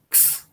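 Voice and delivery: "X" said as a voiceless "ks"; KS sound. Shown as a demonstration. Teacher showing a double consonant, "X" sounded as soft "ks". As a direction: make "X" just a soft k running into s, no vowel. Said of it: "K, S".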